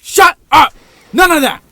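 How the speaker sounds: very high, loud furious shouting; comic screaming fit; mad outburst. Acted. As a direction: blow up in a hammed-up rage. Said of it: "He just got mad".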